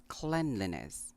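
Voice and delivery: "cleanliness" as KLEN-lee-ness; short e opening "cleanliness": KLEN; correct pronunciation. Shown as a demonstration. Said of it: "the first syllable is pronounced clen".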